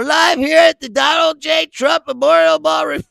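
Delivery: hammed-up ballroom announcer bellowing the name; mock announcer shout. Mocking Trump's ballroom, shouted like an event announcer. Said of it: "They go".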